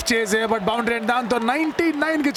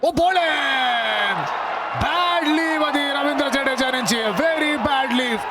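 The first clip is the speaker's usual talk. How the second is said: slow drawn-out shout of surprise; excited exclamation; 4 semitones higher, much slower than usual. Real, not acted. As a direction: exclaim at the delivery. Wicket falls, exclaims.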